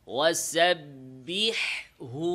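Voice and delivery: ওয়াসাব্বিহহু slow with the double হ clearly sounded; double ha made clear. Shown as a demonstration. Teacher to students, ওয়াসাব্বিহহু with both হ clear. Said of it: "স্পষ্ট করে".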